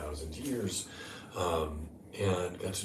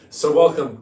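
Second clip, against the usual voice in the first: big welcome; 9 semitones higher, 15 dB louder than usual. Real, not acted. Warm welcome to viewers.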